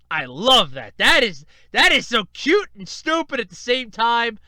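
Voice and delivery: loud, excited fan gushing; real gushing. Real, not acted. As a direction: gush loudly, can't get over it. Gushing over ramen coma picture.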